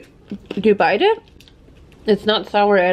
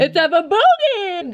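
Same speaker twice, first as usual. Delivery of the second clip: high, hammed-up rallying call; playful party cry; 9 semitones higher than usual. Announcing boogie time before bed, playful party cry. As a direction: call it out high with a long last word.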